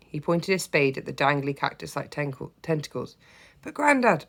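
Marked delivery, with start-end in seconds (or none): 3.6-4.2 put-on high, breathless child's plea; out of breath, pleading; panting. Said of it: "I panted".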